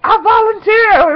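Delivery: high, loud, drawn-out shout; hammed-up desperate movie cry; movie volunteer cry. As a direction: call it out dramatically, slowly. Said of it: "when the chick be like".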